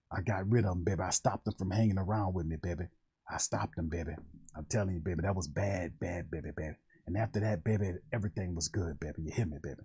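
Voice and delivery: put-on a low, soft old reverend soothing his flock; Reverend Saint family. Low reverend voice comforting the family about a story. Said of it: "that's Reverend Saint family hollering".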